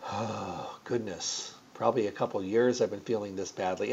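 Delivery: lightly voiced embarrassed lady; sheepish admission. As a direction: mumble it like a caught-out patient. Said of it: "And she went".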